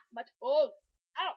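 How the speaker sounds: loud pained cry; stubbed toe. Real, not acted. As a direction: yelp out at the toe pain. Pain in toes, no shoes.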